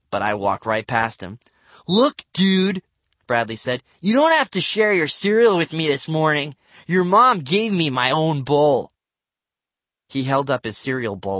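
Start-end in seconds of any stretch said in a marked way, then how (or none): 1.9-2.8 high, loud, cocky address; cocky kid. A narrator's reading. Bradley to Matt over breakfast, cocky and loud. 4.0-8.9 put-on high, loud, bragging kid; cocky boasting. A narrator's reading. Bradley brags to Matt about his cereal bowl, cocky.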